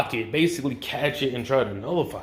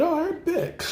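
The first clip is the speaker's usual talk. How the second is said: mock high squeak; slower than usual. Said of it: "He said".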